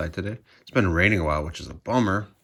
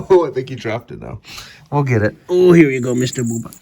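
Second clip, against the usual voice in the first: much louder, excited cheering; 4 semitones higher, 14 dB louder than usual. Real, not acted. Owner loudly cheering as she hands the cat his toy. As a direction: burst out gleefully over the toy.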